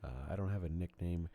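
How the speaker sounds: usual speaking voice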